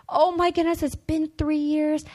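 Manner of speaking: high-pitched retold dismay; mildly dramatized disbelief; distraught teacher. Acts out distraught teacher's reaction.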